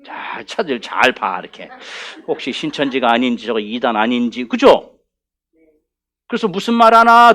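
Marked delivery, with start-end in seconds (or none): 0.0-4.5 low, hushed, suspicious muttering; wary scrutiny. Acted. Church members checking a newcomer for cult ties, suspicious. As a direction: size someone up under your breath. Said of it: "지금은 안 그래".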